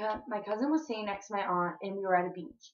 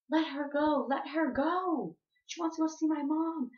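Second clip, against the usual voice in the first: high-pitched imitation of a protesting sister; urgent pleading demand; 6 semitones higher than usual. Sister telling aunt to release crying girl, high urgent plea.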